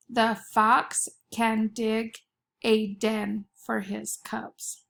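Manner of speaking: slow, careful dictation for spelling. Shown as a demonstration. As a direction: read it slowly so kids can write. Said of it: "Listen closely".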